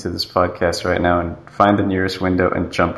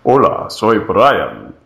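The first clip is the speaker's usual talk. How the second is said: manly Spanish voice; much slower than usual. Retold or imitated. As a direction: say it like a macho Spanish hero. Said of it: "Brian's voice was like".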